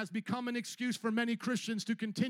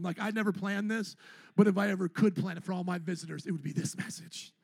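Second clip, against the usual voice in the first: normal talking voice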